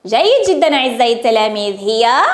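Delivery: usual speaking voice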